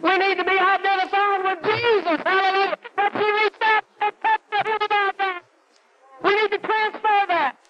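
high, driving preacher's cry over the congregation; full-force preaching. Acted. Preacher stirring up his congregation, full-force preaching.

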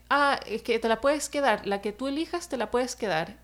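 ordinary delivery

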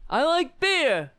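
high, loud indignant yell; Kavanaugh impression. Mocking Kavanaugh at the hearing, high and loud. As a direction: belt it defiantly. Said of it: "Kavanaugh is like that".

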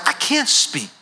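a touch of timid Moses; small voice of a stuttering Moses; Moses protesting. Retold or imitated. Preacher voicing Moses refusing God, lightly timid. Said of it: "Moses is like".

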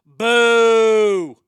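boo it like a hostile crowd; a big booming jeer; loud boo. Acted. Host booing the name of his sister, loud jeer.

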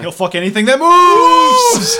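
high, loud, slow shouted line; loud exclamation. Acted. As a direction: bellow it for laughs.

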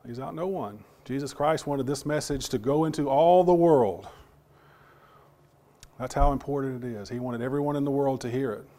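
usual speaking voice

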